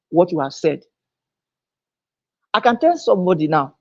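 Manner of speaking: normal talking voice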